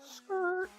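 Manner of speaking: high, soft imitated tire skid; skidding tire screech. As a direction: squeal "skrrt" like a bike drifting. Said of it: "You know the skirt sound".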